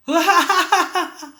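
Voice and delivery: high demo laugh, diminishing; laugh fading loud to soft. Shown as a demonstration. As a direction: burst out laughing, then shrink it away. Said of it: "dari keras ke pelan". (tertawa dari keras mengecil ke pelan)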